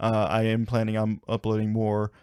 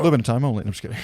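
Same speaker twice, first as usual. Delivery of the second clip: ordinary talk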